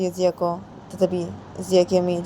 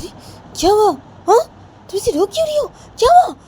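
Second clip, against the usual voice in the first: high, loud startled questions; a parent rushing in to ask what's wrong; alarmed concern; 6 dB louder, faster than usual. Acted. Father finds crying daughter.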